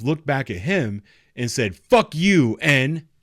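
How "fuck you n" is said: high, loud retold curse; angry shout. Teen cursing at the bailiff, loud angry shout.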